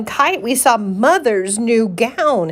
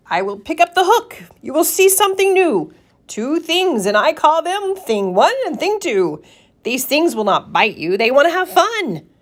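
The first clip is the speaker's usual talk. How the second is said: higher, grand showman voice; showman cat; 7 semitones higher than usual. Acted. Cat presents Thing One and Thing Two, showy. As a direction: unveil the surprise with flourish.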